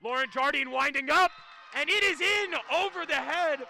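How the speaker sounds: running call climbing to a high, loud peak; live play-by-play. Real, not acted. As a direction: follow the rally up to the point.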